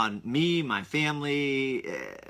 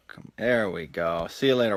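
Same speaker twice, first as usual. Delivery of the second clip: usual speaking voice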